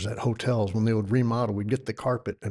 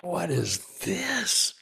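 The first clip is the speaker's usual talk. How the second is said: high, slow, hushed amazement; drawn-out awed wow; awestruck wonder; 6 semitones higher, much slower than usual. Retold or imitated. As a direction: gasp it out like seeing magic for the first time. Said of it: "And I'm just like".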